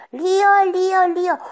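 imitating a mother shouting for her child; mock yelling. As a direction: call a missing child's name, half-yelled. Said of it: "se pone a chillar y a decir".

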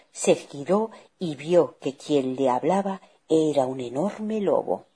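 plain reading voice

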